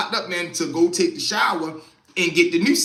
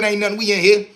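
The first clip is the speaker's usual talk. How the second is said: usual speaking voice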